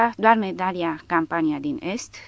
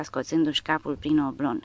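usual speaking voice

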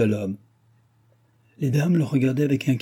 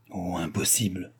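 low-voiced, softer, stunned answer; muted reply; 3 semitones lower, 6 dB quieter than usual. A narrator's reading. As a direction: answer dully, under the voice. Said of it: "répondait sourdement madame de Gramont". (réponse sourde, à voix basse)

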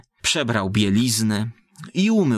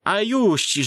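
put-on higher old woman's voice, telling a pitiful tale; gossiping village woman; 4 semitones higher than usual. Village woman tells Ksenia's story. (wysoki głos wiejskiej baby, gawędzący)

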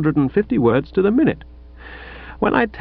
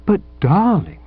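murmured endearments; 6 semitones higher than usual. A narrator's reading. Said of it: "the murmured endearments".

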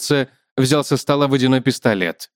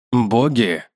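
man's questioning echo; 2 semitones lower than usual. Acted. Man echoes the spider, puzzled.

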